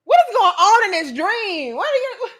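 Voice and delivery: a loud, baffled demand for answers; shocked disbelief. Retold or imitated. Retold confusion at the dream lady, loud and high. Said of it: "I'm like".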